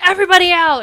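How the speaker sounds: high, loud order to the room; angry clear-the-room yell; storming-out shout. Acted. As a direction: shout it on the way out the door. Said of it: "he, like, storms out".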